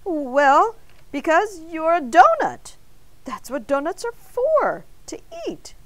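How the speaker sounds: defensive. A narrator's reading. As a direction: explain the obvious, put on the spot. Flustered man defends himself.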